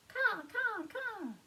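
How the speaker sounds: high, loud cawing; crow call. A narrator's reading. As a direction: caw like a crow for children. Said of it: "Do you know what sound he makes?".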